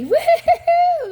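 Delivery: high, loud woo-hoo cheer; excited whoop. Real, not acted. Reader cheering at a lucky card, high woo-hoo.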